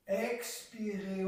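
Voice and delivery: ordinary talk, nothing marked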